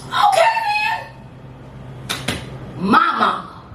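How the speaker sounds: punchy performance poetry with rising set-ups and long pauses; spoken-word poem. Acted. Poet boasting to an audience about mama, proud spoken-word delivery. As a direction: proclaim each line, then land the refrain.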